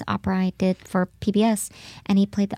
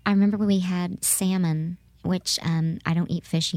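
normal talking voice

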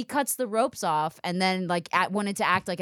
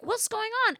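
bewildered waking; 13 semitones higher than usual. Retold or imitated. Waking up tied, acted reaction.

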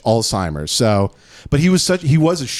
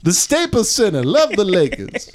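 [name]; 7 semitones higher than usual. Retold or imitated. Comic as the singer muddling venues, showman voice. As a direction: greet the crowd like a befuddled crooner. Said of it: "[name]'s like".

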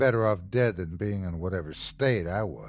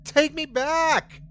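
high, slow screamed order; desperate scream to be taken back; screamed demand; slower than usual. A narrator's reading. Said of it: "I screamed".

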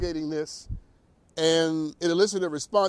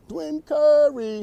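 announcer intro; 10 semitones higher, 6 dB louder, much slower than usual. Acted. Host introduces guest.

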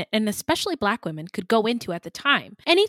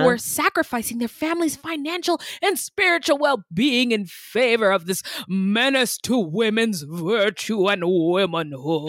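mock preacher. Mocking old moralizing view.

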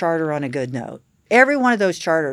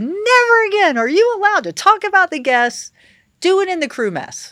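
high, loud telling-off of the staff; raised-voice mock reprimand, pitched way up; retold scolding; 14 semitones higher, 6 dB louder than usual. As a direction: re-enact laying down the law at full voice.

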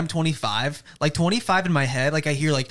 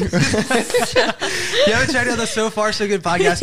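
higher, louder and slow, laughing through the plug; 7 semitones higher, 4 dB louder, slower than usual. Real, not acted. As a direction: push the podcast while cracking up. Podcast plug, laughing together.

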